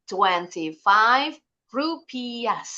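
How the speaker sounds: RUPIAHS slow, silent h, ending in s. Shown as a demonstration. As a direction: model TWENTY-FIVE RUPIAHS very slowly, sounding the final s. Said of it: "Rupiahnya ada bunyi S-nya". (RUPIAHS pelan, h hilang, s berbunyi)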